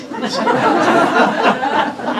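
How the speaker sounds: very high, loud burst of laughter. Real, not acted. Joke-teller laughing hard at his own pirate punchline, loud laugh. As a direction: crack up at your own punchline.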